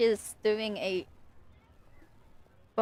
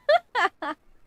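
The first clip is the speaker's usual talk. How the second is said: high burst of real laughter; loud laugh; 10 semitones higher, 6 dB louder than usual. Real, not acted. Streamer cracking up at the name, loud laugh. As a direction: let out a big high laugh.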